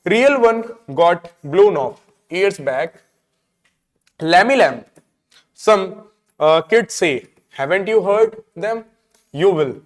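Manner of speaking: slow reading, broken into short phrases; play read-aloud. Acted. Teacher reading Mr Lamb on his leg, slow read-aloud. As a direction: read slowly, pausing after each phrase. Reads the play's lines aloud.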